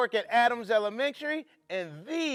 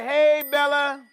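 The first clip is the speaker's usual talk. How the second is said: cheery greeting; 5 dB louder than usual. Shown as a demonstration. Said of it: "Everybody say".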